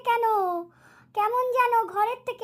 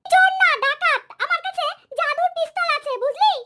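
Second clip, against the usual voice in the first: higher, louder pretend bragging; loud playful boast; 5 semitones higher, 5 dB louder than usual. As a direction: show off your magic pistol loudly. Child boasting in pretend game.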